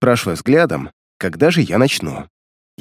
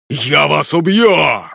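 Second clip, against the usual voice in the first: slow, higher crazed undead yell; crazed cry; 4 semitones higher, much slower than usual. Acted. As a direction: shriek it like a madman. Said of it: "безумно вскрикнул".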